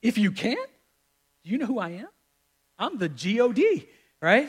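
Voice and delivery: high, indignant rising questions; incredulous Jesus. Acted. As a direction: act affronted at being doubted, pitch climbing. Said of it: "can you imagine Jesus like".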